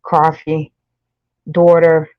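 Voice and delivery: rounded New York aw in each word; New York accent. Shown as a demonstration. Streamer showing where her accent comes out, New York accent. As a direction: list them with New York vowels. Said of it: "you hear my New York accent".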